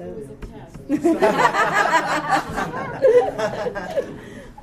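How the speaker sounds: high, long burst of laughter; real helpless laughing; big laugh. Real, not acted. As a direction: crack up at your own joke. Laughing at her own joke.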